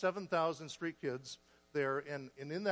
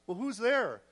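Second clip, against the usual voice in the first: higher, louder mock alarm; retold startled challenge; incredulous question; 6 semitones higher, 6 dB louder, slower than usual. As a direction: demand it like a dismayed wife. Wife reacts in alarm.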